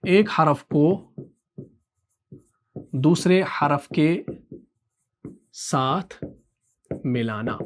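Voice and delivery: word-by-word for note-taking; slow dictation. Shown as a demonstration. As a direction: dictate with long pauses, fading at the end. Dictating the definition for notes.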